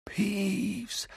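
high, soft, drawn-out hoarse call; hoarse whisper. A narrator's reading. Harry posing as the bloody baron, hoarse whisper. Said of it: "sagte er heiser flüsternd".

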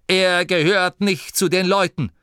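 high, insistent retort; sharp retort. A narrator's reading. Driver insists he is no person, sharply. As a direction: dismiss the objection sharply. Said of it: "erwiderte Angelo scharf".

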